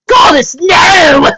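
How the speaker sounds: high, loud, slow screech. Acted. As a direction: shriek it in horror. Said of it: "Screeched Rarity".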